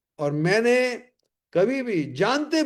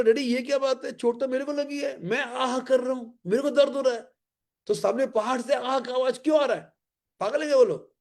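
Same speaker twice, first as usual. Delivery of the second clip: put-on higher, complaining child's voice; angry child; 6 semitones higher than usual. Boy complaining to his father about the echo, higher childish voice. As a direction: whine like a hurt boy. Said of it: "वो बहुत गुस्सा हो गया".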